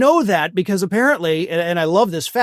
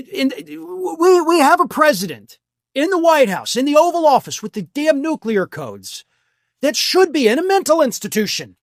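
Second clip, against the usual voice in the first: outraged; 7 semitones higher than usual. Real, not acted. Outraged rant about the president.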